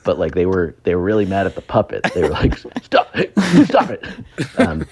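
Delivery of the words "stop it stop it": high, laughing imitation of angry guys; laughing mock plea. Mocking [name]'s friends yelling at the puppet, high and laughing. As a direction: squeal it through a laugh.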